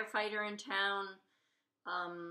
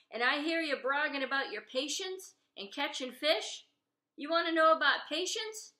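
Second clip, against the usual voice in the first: louder, needling the fishermen; loud lecturing; 4 semitones higher, 6 dB louder than usual. Acted. Saucepan Lynn lecturing the fishermen about patience, loud and teasing. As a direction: scold them and make your point.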